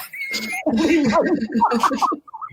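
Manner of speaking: high, breaking burst of laughter; helpless laugh at your own story; real high-pitched laughing fit. Real, not acted.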